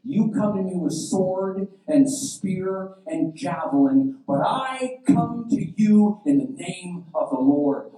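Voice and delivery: high, loud, slow proclamation; defiant declaration. Acted. David answering Goliath on the battlefield, declaiming defiantly. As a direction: declare it boldly, stretching the words.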